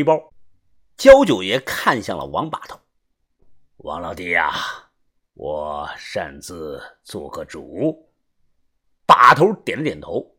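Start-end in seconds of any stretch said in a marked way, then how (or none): 3.8-8.1 put-on lower, softer, measured old man's lecture; a wise elder giving a calm warning; old master voice. Old master Jiao speaks.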